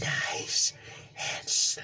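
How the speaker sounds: menacing whisper; villain whisper. Retold or imitated. Green Goblin whispers his threat to Spider-Man. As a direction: hiss it softly like a threat.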